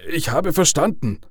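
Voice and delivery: high, halting, stammered submission; frightened stammer. A narrator's reading. Father gives in to the caller, frightened stammer. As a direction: stumble over the first words, scared.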